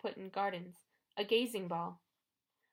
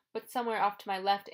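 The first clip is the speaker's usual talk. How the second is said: ordinary delivery, nothing marked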